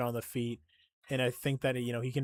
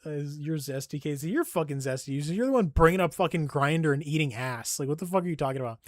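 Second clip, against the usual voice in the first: ordinary talk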